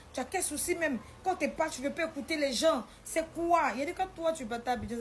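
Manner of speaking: acted telling-off, pressing each question; scolding. Retold argument over caring for the child, scolding.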